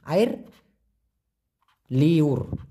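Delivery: আইর and লিওর said apart, slow and clear; careful pronunciation. Shown as a demonstration. Teacher repeating the word properly for learners. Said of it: "ভালো করেই দি".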